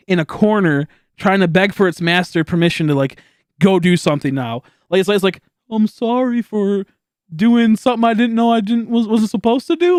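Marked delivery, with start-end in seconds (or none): 5.7-10.0 high, slow whiny pleading; mock groveling apology. Forced to apologize, whining like a dog begging its master. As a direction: whine it like a scolded dog.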